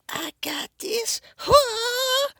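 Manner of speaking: very high falsetto, slow then loud; tiny character squeals confidently, then confused; squeaky character voice. Retold or imitated. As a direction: voice the little dude with a squeaky cry. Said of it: "the dude Fred's like".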